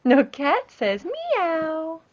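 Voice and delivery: put-on slow, drawn-out words for a toddler; slow teaching. Shown as a demonstration. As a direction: spell it out slowly, leaning on meow. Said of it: "What's the cat say?".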